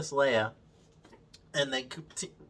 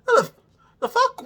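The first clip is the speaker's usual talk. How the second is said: incredulous; 5 dB louder than usual. Real, not acted. Disbelief at plot.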